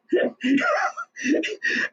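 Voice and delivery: long high-pitched laugh; real hearty laugh. Real, not acted. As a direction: burst out laughing at your own joke. Laughs after joke about embarrassed wife.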